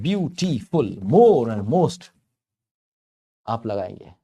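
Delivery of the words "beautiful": beautiful in three separate beats, higher; pauses between syllables. Shown as a demonstration. Teacher showing where the pause comes, beautiful syllable by syllable. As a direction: break beautiful apart as beau, ti, ful. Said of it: "यहाँ पे pause आ जाता है".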